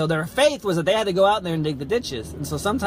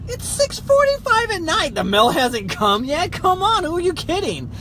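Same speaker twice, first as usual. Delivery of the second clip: high, loud exasperated shouting; incredulous outburst; 13 semitones higher, 5 dB louder, slower than usual. Retold or imitated. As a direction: blurt it out in loud, drawn-out disbelief. Retells his incredulous outburst at tenant.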